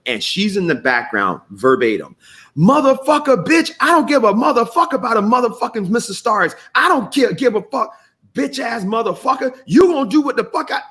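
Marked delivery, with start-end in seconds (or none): 2.6-10.8 mom screaming at son. Retold or imitated. Screaming mother cursing her son in the background. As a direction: shriek the curses at her son like an enraged mother. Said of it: "that's what she was in the back doing".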